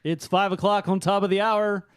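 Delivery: loud, upbeat morning radio DJ; morning zoo DJ. Pretend. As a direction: hype it up like a morning zoo host. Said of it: "going more morning zoo route".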